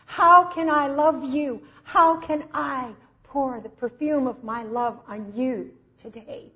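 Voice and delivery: high, loud, drawn-out cry to Jesus; excited devotion. Retold or imitated. Woman cries out to Jesus after reading of love, excited. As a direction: imitate her excited outpouring, stretching the words. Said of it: "she got so excited".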